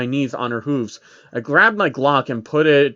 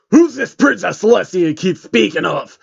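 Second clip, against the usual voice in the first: role; 4 semitones higher, 5 dB louder than usual. Acted. Lil Jon voiced.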